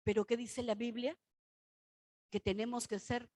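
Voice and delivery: ordinary delivery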